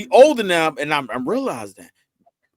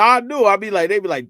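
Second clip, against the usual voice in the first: ordinary talk, nothing marked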